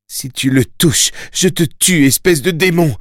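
high, rising fury; a mother's heated, high-pitched threat; angry threat. A narrator's reading. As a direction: threaten him, voice climbing high. Mother threatens her son's captor.

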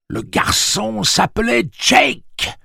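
slow, high, loud inner-voice cry; voix qui crie. A narrator's reading. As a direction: shout it slowly, insisting on every word. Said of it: "Une voix se mit à crier dans son crâne". (cri lent et insistant)

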